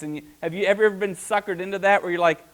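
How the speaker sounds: usual speaking voice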